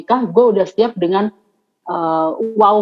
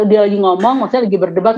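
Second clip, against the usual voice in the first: ordinary talk, nothing marked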